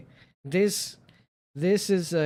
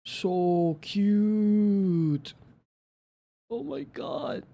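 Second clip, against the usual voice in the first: gushing; 7 semitones higher, much slower than usual. Real, not acted. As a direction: swoon over the cute cat. Gushing over cute cat.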